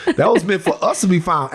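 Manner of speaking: quoting him through laughter; mock pious line broken by laughs; laughing. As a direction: laugh your way through the quote. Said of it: "He goes".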